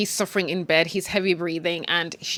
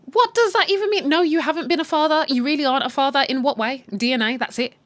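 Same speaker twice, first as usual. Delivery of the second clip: indignant rant; 7 semitones higher, 4 dB louder than usual. Real, not acted. Ranting at the TV.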